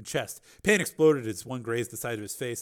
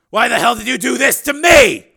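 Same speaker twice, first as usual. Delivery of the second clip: high, full-volume angry shout; furious counter; 13 semitones higher, 19 dB louder than usual. A narrator's reading. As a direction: hurl the question back, shouting. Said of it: "he countered".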